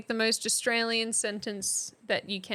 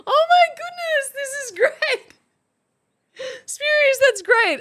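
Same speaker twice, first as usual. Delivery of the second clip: much higher and louder, squealing with joy; delighted; 12 semitones higher, 7 dB louder than usual. Real, not acted. Streamer thrilled by a viewer's sentence, excited squeal. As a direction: gush over it like a thrilled fan.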